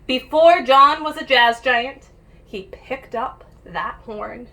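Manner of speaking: put-on lively read-aloud for kids; picture book reading. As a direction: read it like storytime for little ones. Reads picture book to children.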